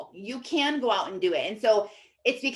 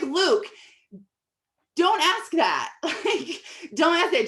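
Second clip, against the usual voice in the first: higher, louder mock-mortified reprimand; embarrassed scolding; 8 semitones higher, 4 dB louder, slower than usual. Embarrassed mom stopping her son's money question, high exaggerated scolding. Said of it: "instantly embarrassed".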